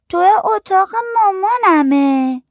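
put-on very high, drawn-out little kid; small child's voice. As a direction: stretch it out in a high kiddie voice. Narrator voices small child answering.

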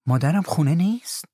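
puzzled question, pitched high; astonished. A narrator's reading. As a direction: ask in surprise, voice lifted. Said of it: "حیرت زده از پگوتی پرسیدم".

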